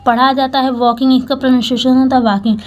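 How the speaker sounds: ordinary talk, nothing marked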